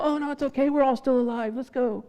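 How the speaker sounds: high, soft, easygoing; breezy reassurance from the other driver; forgiving reply. Retold or imitated. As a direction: wave it off kindly and gently. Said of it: "And they go".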